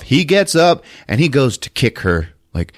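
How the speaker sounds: usual speaking voice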